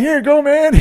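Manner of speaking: very high, loud offer; hammed-up high yell; eager handover. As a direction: imitate a stoner shouting a helpful reply. Acted reply handing over piss jar.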